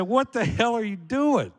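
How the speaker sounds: retold outburst of disbelief; incredulous protest. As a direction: demand it in mock alarm. Acted protest to the doctor.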